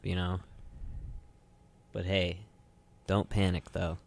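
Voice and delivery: normal talking voice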